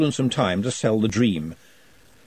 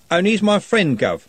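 put-on higher, louder, pleading young man; earnest young street lad; 8 semitones higher, 4 dB louder than usual. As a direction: stand up for your friend to the boss. Trev protests to Ridcully.

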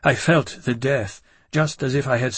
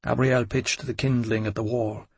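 ordinary delivery, nothing marked

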